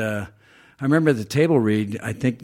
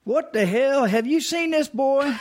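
high Southern drawl, slow at the end; Southern Terminator; slower than usual. Retold or imitated. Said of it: "if you were like".

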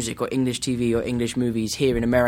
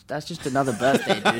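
laid-back dude. Retold or imitated. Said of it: "everyone back home was like".

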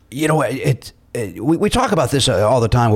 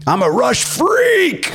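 slow, proud fan voice; 11 semitones higher, much slower than usual. Retold or imitated. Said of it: "And he said".

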